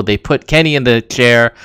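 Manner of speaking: usual speaking voice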